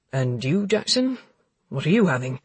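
light male friend voice; cheerful deflecting man. Acted. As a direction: hurry to change subject. Warren voiced in dialogue.

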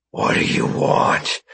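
fearful whisper. Acted. Knife approaching, strapped down.

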